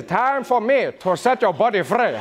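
mock-seductive South African statesman accent; Nelson Mandela impression. Comedian seducing as South African statesman, Mandela-style accent. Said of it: "like, the Mandela accent?".

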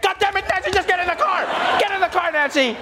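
high, frustrated mock yell; exasperated yell. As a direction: order her into the car, fed up. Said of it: "It's like".

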